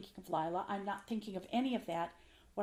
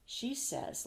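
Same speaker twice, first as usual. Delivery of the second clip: ordinary talk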